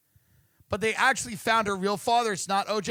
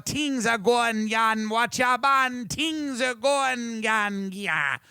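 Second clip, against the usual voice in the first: mock Irish accent; 2 semitones higher, much slower than usual. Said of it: "what I do with Irish, I become Indian".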